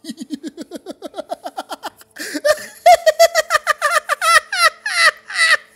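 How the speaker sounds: high, loud cackling laugh; loud laughter. Real, not acted. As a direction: crack up helplessly. Bursts out laughing.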